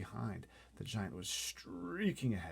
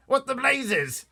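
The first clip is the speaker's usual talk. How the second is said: high, loud, slow outraged bellow; blustering officer exploding; shouting Air Force chief; 15 dB louder, much slower than usual. Acted. Air Force head shouting at the pilot, outraged. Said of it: "shouted the head of the Air Force".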